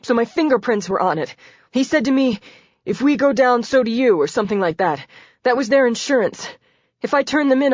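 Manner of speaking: a cornered man spilling everything; frightened confessor. A narrator's reading. Damon confessing, voiced high.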